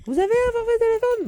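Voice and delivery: high squeaky clerk voice; mock the nagging post office lady; mimicking clerk. (voix aiguë de guichetière agaçante)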